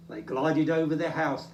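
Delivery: usual speaking voice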